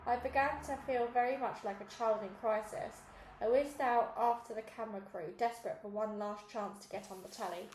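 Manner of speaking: ordinary delivery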